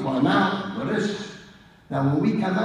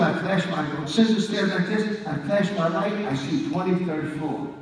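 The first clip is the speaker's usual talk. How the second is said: ordinary delivery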